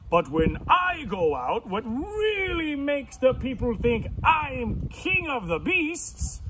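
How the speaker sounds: big lion voice, swooping up high; boastful lion. Acted. Lion brags to pig about his mane, grandly. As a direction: show off like a vain lion.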